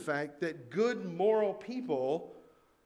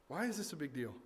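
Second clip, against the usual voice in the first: the question dropped much softer; softer repeat; 4 semitones lower, 13 dB quieter, faster than usual. Shown as a demonstration. Preacher repeating his question quietly to the congregation, hushed. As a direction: ask it again, softly, letting it sink in.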